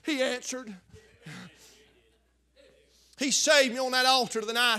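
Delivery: normal talking voice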